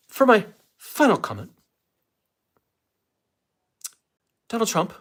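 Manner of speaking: high, loud news-anchor sign-off; Rachel Maddow impression. Streamer mimicking Rachel Maddow to viewers, high and loud. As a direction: announce the name like a TV host. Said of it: "I'm trying to do a Rachel Maddow impression".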